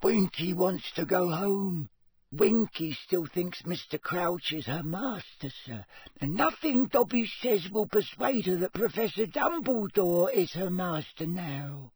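a fretful little elf pleading for his friend; house-elf voice. Acted. Held Dobby elf voice.

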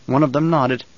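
plain reading voice

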